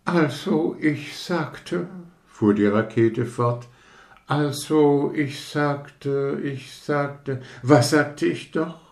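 lightly voiced vain rocket, losing its thread; a hint of a self-important firework fumbling for words; pompous rocket. Rocket reminds the others what it was saying, faintly pompous.

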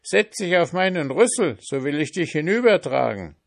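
high, sly pig voice; grunting pig. A narrator's reading. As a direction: coax him like a sly pig. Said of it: "Grunzte das Schwein".

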